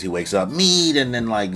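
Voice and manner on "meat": mock scream. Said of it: "Luffy screams".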